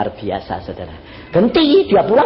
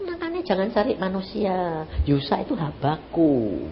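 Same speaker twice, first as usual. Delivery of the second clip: soft, slow, lower tender words; tender voice of Jesus; 4 semitones lower, 6 dB quieter, slower than usual. Acted. Preacher voices Jesus comforting the weeping woman, soft and slow. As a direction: speak gently like comforting a child.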